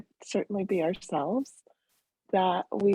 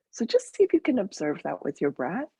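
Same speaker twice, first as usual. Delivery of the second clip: guided meditation; 4 dB quieter than usual. Real, not acted. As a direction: lead them gently into the breath, unhurried.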